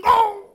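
sudden loud high "oh!" of strain; strained grunt. Retold or imitated. Old man groaning as he tries to lift something. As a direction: act out a back twinge while lifting.

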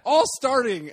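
high, loud outraged exclamation. Real, not acted. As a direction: blurt it out in disbelief. Outraged at the lineup.

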